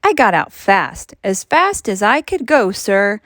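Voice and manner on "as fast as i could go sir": usual speaking voice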